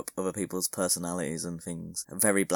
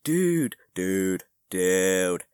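mock calling out; 9 dB louder, much slower than usual. Podcaster mimicking own habit of saying dude, loud mock calling. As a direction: blurt it out in exaggerated bursts.

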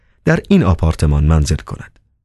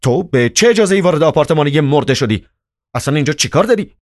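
higher-pitched indignant questioning; indignant challenge; 9 semitones higher, faster than usual. A narrator's reading. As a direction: challenge the intruder, speeding up at the end. Chairman confronts intruder in flat.

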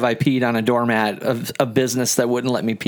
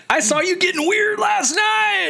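teasing shout. Retold or imitated. Calling out a clubgoer spotted in public, teasing shout. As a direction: shout it out to embarrass them.

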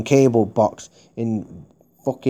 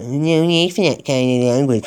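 mocking sing-song repeat of the call-centre line, a bit higher; sarcastic mimicry; 4 semitones higher, 3 dB louder than usual. Caller mocking the call-centre's scripted reply, sneering. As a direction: parrot their stock phrase back with scorn. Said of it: "We always say that".

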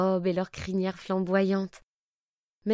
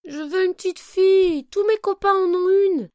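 put-on whiny child; 8 semitones higher, 7 dB louder than usual. Child pony whining at parents.

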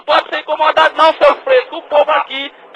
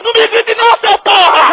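angry shout; 6 semitones higher than usual. Real, not acted. Angry phone quarrel.

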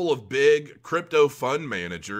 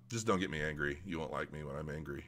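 mock menace; 8 semitones lower, 11 dB quieter than usual. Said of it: "I'm just messing around".

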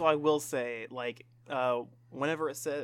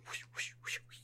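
whispered whoosh; 15 dB quieter, faster than usual. Pretend. Imitating fishing pole swings.

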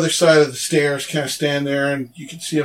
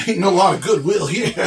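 line breaking into a laugh; scoff, then burst out laughing in character; scoffing ogre laugh; 4 semitones higher, faster than usual. Acted. Ogre answering talk of goodwill, laughing in derision. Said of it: "ogre burst out laughing again".

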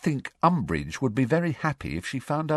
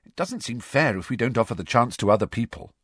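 anxious Hermione; 8 semitones higher than usual. A narrator's reading. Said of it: "looking a mite anxious again".

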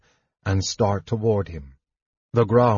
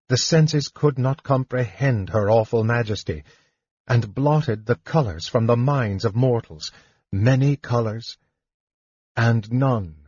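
ordinary delivery, nothing marked

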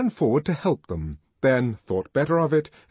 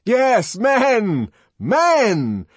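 loud, high, emphatic cries; triumphant repeated yell; exultant shout; 11 semitones higher, 7 dB louder, much slower than usual. A narrator's reading. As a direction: cheer them on at full voice. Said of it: "the savage shouted".

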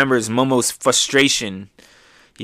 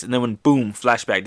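normal talking voice

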